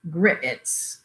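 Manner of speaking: "grits" stretched into two syllables, grit-its. A narrator's reading. Narrator mimics the waitress, "grits" in two syllables, "grit-its". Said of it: "as if it had two syllables".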